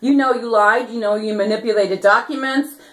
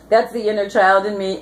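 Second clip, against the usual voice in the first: ordinary talk, nothing marked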